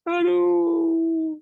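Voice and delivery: stage a hammed-up cry of grief; demo wailing. Shown as a demonstration. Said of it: "kita bikin nangis terus ketawa".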